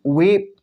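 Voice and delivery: वीप with a drawn-out ee; long ee in weep. Shown as a demonstration. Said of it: "ई का उच्चारण को लंबा खींचा". (वीप में लंबी ई)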